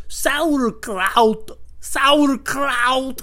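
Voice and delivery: put-on loud, high foreign-accent "Sauerkraut"; hammed-up accented shout, right in the face; spitting accented man. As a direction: spit out the word in a mock heavy accent. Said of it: "he's, like, spitting into my mouth".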